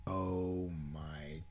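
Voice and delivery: very slow, low and hushed; stunned, drawn-out near-murmur; hushed disbelief. Real, not acted. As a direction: let each word drag out quietly in dismay. Earnest insistence he was only kidding.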